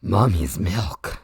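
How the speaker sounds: low, slow cursed voice; imitating roommate. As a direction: drop low for a creepy stim. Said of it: "Mimi's vocal stims are like".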